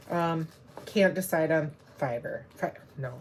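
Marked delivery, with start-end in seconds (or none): none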